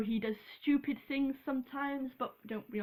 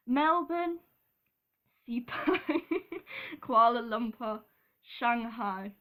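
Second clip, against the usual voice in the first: attempted Australian accent; 3 dB louder than usual. Shown as a demonstration. Vlogger attempts the circuit's name in an Australian accent. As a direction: have a shaky-confidence try at Australian. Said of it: "I can't do an Australian accent now".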